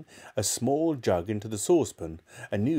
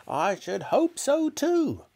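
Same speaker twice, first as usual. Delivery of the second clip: put-on high, slow, grumpy old woman; grumpy reply; slower than usual. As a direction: grumble it like a sour granny. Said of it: "came the grumpy reply".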